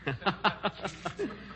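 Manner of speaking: soft, high private giggle; self-pleased chuckle. Acted. Comedian alone enjoying his own gag, soft chuckle. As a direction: chuckle quietly at your own wit.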